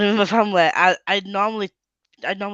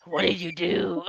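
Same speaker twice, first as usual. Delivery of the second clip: lower-pitched pretend interrogation; mock interrogator; 5 semitones lower, slower than usual. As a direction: play at grilling a suspect. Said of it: "like you're trying to interrogate me".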